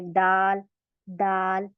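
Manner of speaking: दाल with the tongue tip at the root of the upper teeth; a clean alveolar d in दाल; correct dal. Shown as a demonstration. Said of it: "तब हमारा दाल अदा होता है". (दाल, सही मखारिज से)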